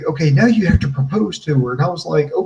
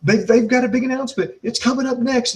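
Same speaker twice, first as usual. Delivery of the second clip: high-pitched mock commentator line; feed the line in a raised announcer-like pitch; D'Angelo Dinero; 9 semitones higher than usual. Host supplying the commentator's better line, high mock announcer. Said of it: "D'Angelo Dinero could have said".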